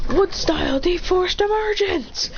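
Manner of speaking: high, drawn-out move announcement; play-acted anime battle cry; attack call. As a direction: call out the jutsu name slowly and high. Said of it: "Naruto goes".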